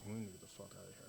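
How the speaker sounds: hushed and fast, out of the side of the mouth; side-of-mouth whisper. Retold or imitated. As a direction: mutter it quickly so the muggers can't hear. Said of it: "out the side of my mouth".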